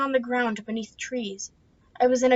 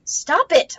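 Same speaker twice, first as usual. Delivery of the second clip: higher, louder stop command; firm shout; 6 semitones higher, 6 dB louder than usual. Acted. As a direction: shout her down. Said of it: "I shouted".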